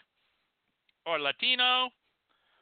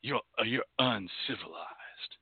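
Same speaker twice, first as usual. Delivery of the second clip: mock haughty voice; 8 semitones lower, 7 dB quieter than usual. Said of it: "they'll say".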